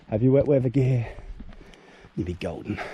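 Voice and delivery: ordinary talk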